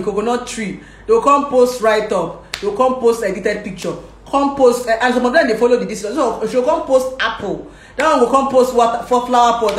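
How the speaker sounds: ordinary delivery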